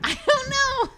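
high, loud, embarrassed outburst; laughing protest. Real, not acted. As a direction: protest helplessly through a laugh. Teased about accent, bursts out embarrassed.

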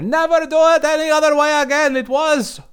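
high, loud praise shouted from the back; retold yell. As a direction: call it out across the theatre. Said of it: "I yelled from the back of the theater".

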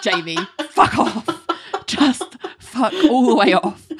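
half-laughing, exasperated telling-off; building mock outrage; mock exasperation. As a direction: tell them off, amused and fed up. Exasperated reaction to Jamie turning up.

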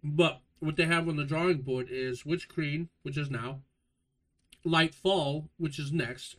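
ordinary talk, nothing marked

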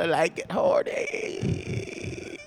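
breathy hushed whisper, drawn out slowly. Acted. To sibling, confessing he's aroused, slow whisper. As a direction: let it slip out in a slow whisper.

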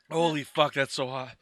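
normal talking voice

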